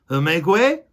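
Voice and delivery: a loud, high call to make way; Furlow's voice. Acted. Furlow calling to the crowd of mice. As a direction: push through the crowd calling out. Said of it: "said Furlow".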